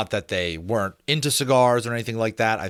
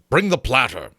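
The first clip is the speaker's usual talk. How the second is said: louder barked request; Berkeley, gruff order; 4 semitones higher, 6 dB louder than usual. Acted. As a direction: demand the food loudly.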